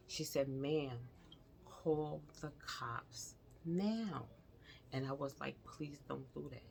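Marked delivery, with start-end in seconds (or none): 0.5-4.3 slow firm demand. Retold or imitated. Nurse insisting, drawn out. 5.6-6.6 soft plea. Retold or imitated. Begging the nurse, first take.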